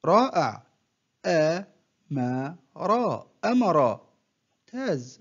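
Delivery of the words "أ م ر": one short syllable at a time, with pauses; broken into syllables. Shown as a demonstration. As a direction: separate each syllable for a learner to copy. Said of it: "تهجأ". (تقطيع الكلمة مقطعًا مقطعًا بوقفات)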